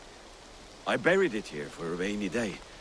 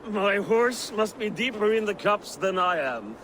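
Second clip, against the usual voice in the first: calling out; 10 semitones higher, 4 dB louder than usual. Acted. Drunk shouting while riding.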